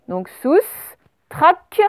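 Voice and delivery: soustrac drawn out very slowly; slow teacher dictation. Shown as a demonstration. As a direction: say soustrac slowly, sounding every letter. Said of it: "on n'oublie pas le T".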